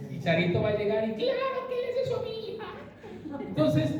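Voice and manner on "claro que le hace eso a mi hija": high, rapid outburst as an outraged parent; mock protest, pitched way up and rushed; indignant relative. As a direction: put on a high, fast indignant voice. Voicing indignant relative in scenario.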